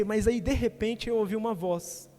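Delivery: ordinary talk, nothing marked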